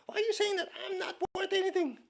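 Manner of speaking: mock teary protest from an upset student; mock upset whine. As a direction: parody an overly touchy listener. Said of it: "people who are very emotionally upset".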